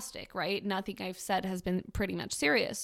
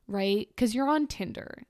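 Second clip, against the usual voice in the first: ordinary talk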